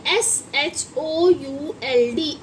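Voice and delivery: letters spelled slowly one by one; slow spelling out. Shown as a demonstration. Teacher spelling SHOULD slowly for the class. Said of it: "इसे हम should नहीं पढ़ते हैं".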